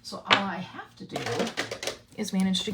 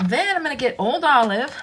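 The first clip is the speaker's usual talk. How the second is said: normal talking voice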